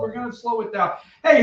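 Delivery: ordinary delivery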